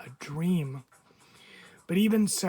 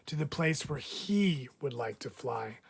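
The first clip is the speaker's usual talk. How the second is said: ordinary delivery, nothing marked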